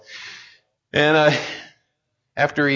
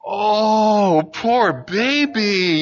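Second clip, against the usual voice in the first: high, drawn-out coo of pity; a parent cooing poor baby to a hurt child; pitying baby talk; 6 semitones higher, much slower than usual. Retold or imitated. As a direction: coo it at her like comforting a baby. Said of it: "you start kinda doing that thing".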